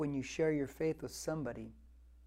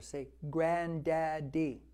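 slow, drawn-out granddaddy, each syllable stretched; coaching a toddler; much slower than usual. Retold or imitated. Grandfather teaching granddaughter her first word, slowly. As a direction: coax a baby into saying granddaddy. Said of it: "I always tell her".

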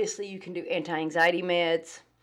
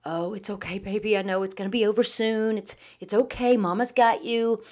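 put-on higher, gushing baby-talk comfort; over-soothing; 4 semitones higher than usual. Shown as a demonstration. Owner over-soothing a frightened dog in a storm, anxious high baby talk. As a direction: fuss over the dog like a worried mama. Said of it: "That's a little different, and you can hear it in the voice".

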